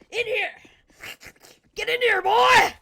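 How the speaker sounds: high, loud, building yell; mock angry shout. Principal hauling Mason into a room, hammed-up yell. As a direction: imitate a furious principal ordering a student in.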